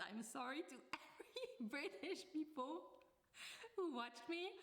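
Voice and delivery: high-pitched, laughing through the words; laughing apology. Real, not acted. Vlogger apologises to British viewers for the accent, laughing. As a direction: crack up while apologising. Cracks up at own bad accent.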